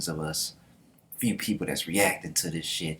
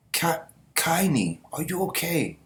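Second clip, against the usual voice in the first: worried soldier; 8 semitones higher than usual. Retold or imitated. Soldier checks on comrade.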